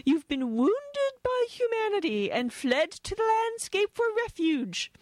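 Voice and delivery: put-on high, fluting upper-class English accent; plummy British trill. Imitating the British interviewer on stage, trilling plummy English. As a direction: lilt it like a posh British host, mock-sympathetic. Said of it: "she trilled".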